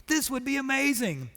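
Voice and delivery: the optimistic half of his brain cheering; eager inner voice. Retold or imitated. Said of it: "these two little parts of my brain".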